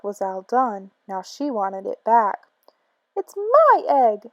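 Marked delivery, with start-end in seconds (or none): 3.2-4.3 higher, slow indignant sputter. Acted. Maisie demanding the egg back, sputtering. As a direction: spit the words out. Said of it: "she sputtered".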